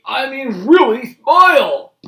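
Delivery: high, loud, slow insistence; angry Grandpa. Acted. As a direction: demand a real smile. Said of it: "shouted Grandpa angrily".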